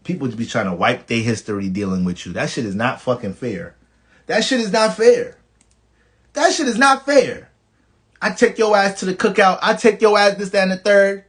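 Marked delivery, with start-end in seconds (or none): 4.2-7.5 indignant outburst. Real, not acted. Repeated louder complaint about unfairness.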